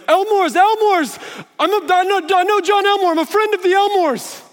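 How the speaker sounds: high, loud frantic pleading; panicked pleading. Retold or imitated. Man backing from a big guy, pleading loud and high in panic.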